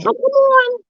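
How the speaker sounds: high, eager invitation; excited call. Real, not acted. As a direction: pull them in with energy. Calling viewers to join.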